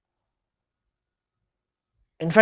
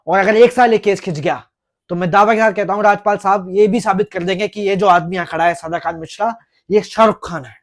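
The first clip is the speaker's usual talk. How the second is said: higher and much louder, scornful ranting; sarcastic shouting; 5 semitones higher, 8 dB louder than usual. Acted. Lawyer shouts his mocking point at the court, loud and scornful. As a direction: hammer the absurdity home at full volume.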